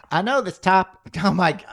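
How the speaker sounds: high-pitched failed Boston attempt; badly faked Boston accent. Shown as a demonstration. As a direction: try Boston and give up. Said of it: "all right, I'll try it".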